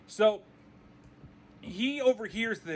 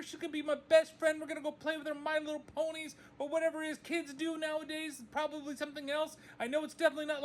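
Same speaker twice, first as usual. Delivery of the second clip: put-on higher, gushing excited kid voice; giddy girl's voice, higher, babbling to her mom; 7 semitones higher than usual. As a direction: gush to mom like a giddy young girl. Voicing excited daughter calling mom.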